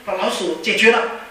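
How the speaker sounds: ordinary delivery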